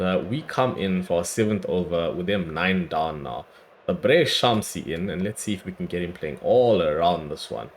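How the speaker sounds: normal talking voice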